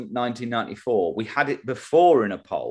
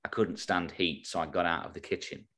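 quiet explaining; 6 semitones lower, 10 dB quieter than usual. Shown as a demonstration. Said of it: "If you don't get that joke".

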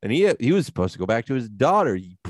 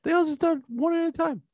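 squeaky sing-song complaint; squeaky cartoon voice; high mock voice. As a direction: pitch it way up like a cartoon. High put-on voice.

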